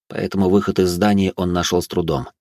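usual speaking voice

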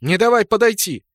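high, loud battle call; shout. A narrator's reading. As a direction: yell across the field. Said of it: "На мой крик".

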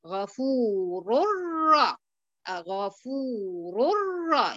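"غفور الر" very slow, noon merged into ra; idgham into ra. Shown as a demonstration. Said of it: "انتبهي ل". ("غفور الر" بالإدغام ببطء)

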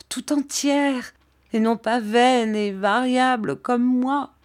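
high, distressed self-reproach; acted anguished outburst; crying out. A narrator's reading. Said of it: "s'écria-t-elle".

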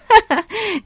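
put-on high little girl giggle; girlish giggle. A narrator's reading. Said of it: "хихикнула Глэд".

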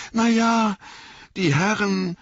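high, hesitant sheepish start; a bit embarrassed. A narrator's reading. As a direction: stall awkwardly before owning up. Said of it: "sagte Sam ein bisschen verlegen".

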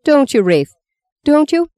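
put-on old woman taunting the priest. A narrator's reading. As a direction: tease him, needling. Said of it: "She began to laugh".

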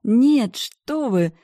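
high, loud squealed denial; giddy denial. Acted.

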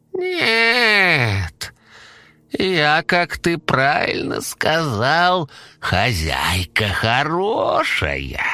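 very high, slow sugary squeak; pleased squeaky monster. Acted. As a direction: invite them in with a sweet squeak.

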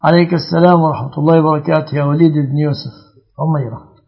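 normal talking voice

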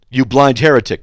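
loud, hammed-up you blind heretic, blind stressed; tacking the insult on for a laugh, loud mock jab; punchy mock insult. Shown as a demonstration. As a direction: slam it on the end as a punchline. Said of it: "just thrown on the end".

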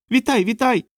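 very high, piping greeting; furry little folk. A narrator's reading. Furry little men greeting Rokanon back, high squeaky chorus voice. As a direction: squeak it like tiny furry people. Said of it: "промовили похнасті чоловічки".

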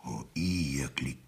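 a quick whisper under the breath; whispered aside. Real, not acted. Judge Adam, cornered, whispers an aside to himself. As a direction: mutter it so the court won't hear.

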